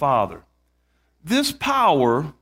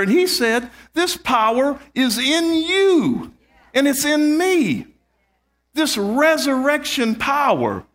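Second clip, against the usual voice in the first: preaching at full force; 6 semitones higher than usual. Acted. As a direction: preach it with rising fire to the congregation.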